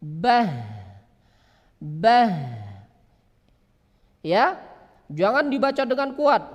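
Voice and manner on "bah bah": bah pushed hard and low from the chest; heavy wrong ha. Shown as a demonstration. Teacher shows the wrong heavy ha. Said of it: "itu salah".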